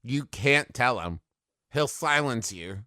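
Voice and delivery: usual speaking voice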